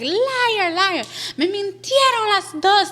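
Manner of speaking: high-pitched mock accusation; imitated aggressive mother. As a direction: scold them like mom right in their face. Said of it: "very aggressive".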